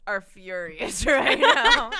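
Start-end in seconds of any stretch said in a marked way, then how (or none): 1.1-1.9 loud words through laughter; cracking up mid-sentence; laughing. Real, not acted. Laughing at the accent attempt.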